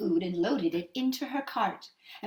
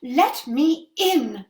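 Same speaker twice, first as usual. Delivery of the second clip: loud, very slow drawn-out call; 4 semitones higher, 9 dB louder, much slower than usual. Acted. Mother at the witch's door, calling slowly and loudly. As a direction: stretch the call out long. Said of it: "the mother called".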